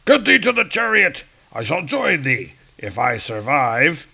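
commanding Hercules. Acted. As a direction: send the robot off. Hercules orders.

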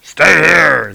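loud, curt order; firm shout. Retold or imitated. Heroes order Spider-Man to stay, loud and firm. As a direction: bark it at the kid. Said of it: "they both say together".

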